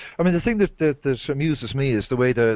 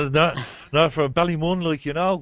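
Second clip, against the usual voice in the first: put-on Dublin Northside accent, a little higher; thick Ballymun accent; 4 semitones higher than usual. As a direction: imitate a thick Ballymun local. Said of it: "Ballymun's really thick accent".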